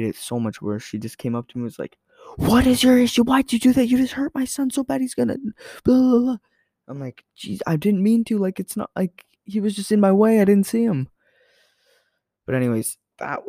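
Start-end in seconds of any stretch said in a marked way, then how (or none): 2.4-6.4 mocking an angry mom, much higher; shrill mock tirade from the mom. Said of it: "starts screaming at me". 7.4-11.1 defensive whine. Retold or imitated. Retold defensive reply to mom.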